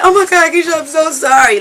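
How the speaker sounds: high, loud mock-tearful gushing; overcome fan. Girls come up to the singer, overcome gushing. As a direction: imitate a starstruck girl apologizing in a squeal. Voices overcome fans approaching her.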